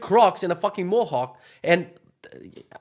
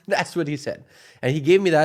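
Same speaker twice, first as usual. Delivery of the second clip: ordinary delivery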